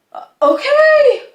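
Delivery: very high, loud, startled squeak; acted-out flustered yelp; startled compliance. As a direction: blurt it out in a panicked high voice. Panicked reply to being yelled at.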